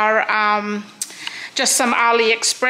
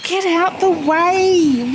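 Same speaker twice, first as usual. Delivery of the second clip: loud and slow; 5 semitones higher, 5 dB louder, slower than usual. Real, not acted. Horse pushing in at the gate.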